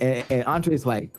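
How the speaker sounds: ordinary talk, nothing marked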